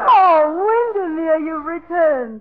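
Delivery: breathless gushing delight; swooning. Acted. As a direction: gush over the returning lover. Swooning at old love.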